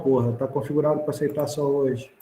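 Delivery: usual speaking voice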